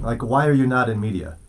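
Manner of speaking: ordinary talk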